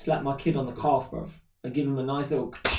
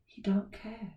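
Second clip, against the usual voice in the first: whisper; 7 semitones higher, 7 dB quieter, slower than usual. Real, not acted. Aside about son.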